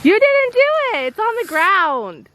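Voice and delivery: high teasing accusation; excited. Real, not acted. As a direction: call him out gleefully. Teasing kid who faked eating.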